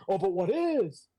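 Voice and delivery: high, indignant mock retort; accusing retort. Acts Betty's accusing retort.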